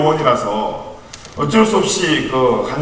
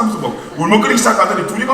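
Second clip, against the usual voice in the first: rushed narration with a laugh; fast, amused storytelling; 7 semitones higher, faster than usual. Real, not acted. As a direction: laugh through it quickly. Laughing while telling the story.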